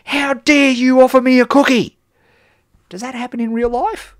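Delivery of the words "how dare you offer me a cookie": high, loud mock outrage, indignant; a hammed-up cry of indignation, much higher and louder; outraged reader. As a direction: play the offended reader flinging the tray away. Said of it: "and say".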